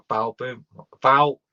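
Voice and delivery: words tumble into a garbled slip; slurred slip. Real, not acted. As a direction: trip over the word bowel. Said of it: "Another mini stroke".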